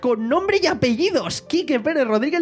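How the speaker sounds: amused disbelief. Real, not acted. Building outrage at the commenter.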